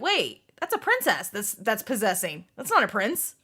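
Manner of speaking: usual speaking voice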